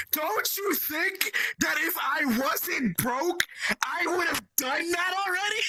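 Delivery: high, incredulous protest; indignant retort. Real, not acted. Answering a friend's advice to buy a tracker, indignant retort. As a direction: push the pitch up in exasperation.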